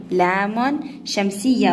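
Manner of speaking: ordinary talk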